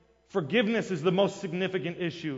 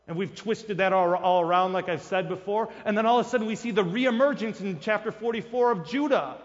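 usual speaking voice

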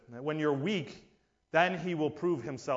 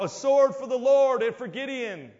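high, louder war cry; rallying shout of the army; battle cry; 7 semitones higher, 6 dB louder than usual. Acted. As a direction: call it out across the camp. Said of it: "this cry goes out".

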